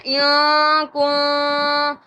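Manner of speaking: ایاں کون drawn very long through the nose; nasal ghunnah. Shown as a demonstration. Teacher models for students, long nasal holds on ایاں کون. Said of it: "اس طرح پڑھنا ہے".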